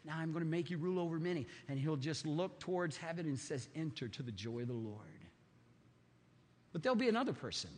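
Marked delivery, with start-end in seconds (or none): 0.0-5.3 gentle voice of God. Pretend. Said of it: "God will say".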